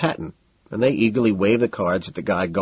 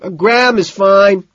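high, loud, slow reply; drawn-out high yell; shout; 10 semitones higher, 8 dB louder, slower than usual. A narrator's reading. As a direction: holler back, stretching it out. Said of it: "I shout".

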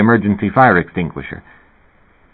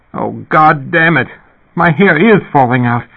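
higher, louder cry of distress; in evident pain; 7 semitones higher, 4 dB louder than usual. A narrator's reading. Woman finds her hair falling out, pained cry. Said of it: "in evident pain".

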